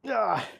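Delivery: loud effort grunt; strained grunt. Real, not acted. Strain after last set.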